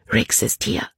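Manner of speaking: whispered urgent news; girl whispering the warning to Caleb; hushed. A narrator's reading. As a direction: breathe it out secretly. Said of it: "sagte Libby schnell".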